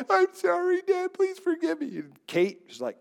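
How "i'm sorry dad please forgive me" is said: put-on much higher childlike pleading voice; imitated little girl. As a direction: plead like a small child saying sorry. Little daughter repenting after correction.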